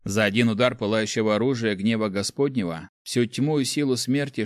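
ordinary delivery

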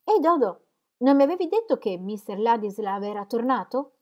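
high, loud astonished question; surprised. A narrator's reading. Celia asks Dodo about Ladislav's return, surprised. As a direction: call out to her, taken aback. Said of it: "un altro genere di espressione sorpresa".

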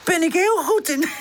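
high-pitched, cheeky boast; high, pleased-with-himself rascal; mischievous. A narrator's reading. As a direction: show off naughtily in a high voice. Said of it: "zegt Charlie ondeugend".

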